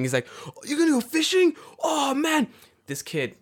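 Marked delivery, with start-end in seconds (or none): 0.5-2.5 put-on excited kid. Kid excited about fishing.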